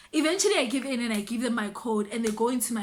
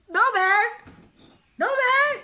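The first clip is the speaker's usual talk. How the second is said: high, loud exclamation of disbelief; emphatic disbelief; 9 semitones higher, 5 dB louder than usual. Real, not acted. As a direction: dismiss it loudly, pitch jumping up. Rejecting the absurd idea.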